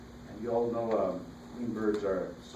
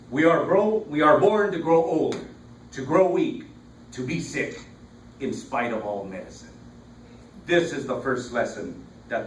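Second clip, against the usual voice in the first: formal quote reading; 3 semitones higher, 8 dB louder than usual. Acted. Poet opening a reading with a Montaigne quote, declaimed. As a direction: project the quote, then let it fall away.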